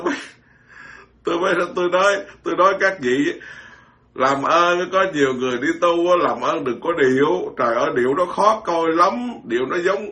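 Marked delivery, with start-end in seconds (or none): none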